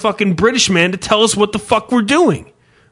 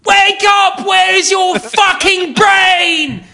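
chef yelling; 12 semitones higher, much slower than usual. Retold or imitated. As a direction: scream down a line cook like a TV chef.

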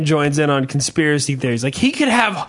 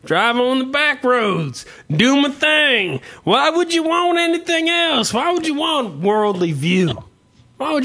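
mock proud Southerner; 6 semitones higher than usual. Host voicing proud Southerner.

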